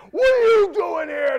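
slow, high, loud demonic scream; possessed man screaming at Jesus. Acted. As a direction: scream it at the intruder. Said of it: "They're screaming at him".

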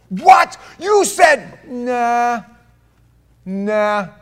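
loud, high, excited retelling of the ruling; high and loud, shouting the verdict in wonder; astonished outburst. Retold or imitated. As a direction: burst out in amazed disbelief. Pastor retells a courtroom ruling.

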